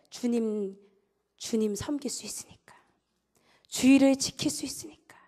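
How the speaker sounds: hushed, unvoiced emphasis; preacher's whisper. Preacher whispers to the congregation for emphasis. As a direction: drop to a whisper to draw them in.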